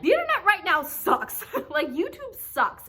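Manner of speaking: exasperated laugh at the state of things; laughing exasperation. Real, not acted. Laughing rant about the internet.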